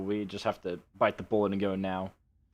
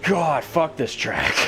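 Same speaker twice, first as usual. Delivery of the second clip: slow angry outburst; frustrated shout; 6 semitones higher, 5 dB louder, slower than usual. Real, not acted. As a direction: yell at the track in disgust. Frustrated after losing spots.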